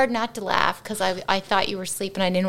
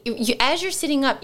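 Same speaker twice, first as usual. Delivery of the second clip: ordinary delivery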